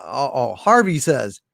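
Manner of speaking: usual speaking voice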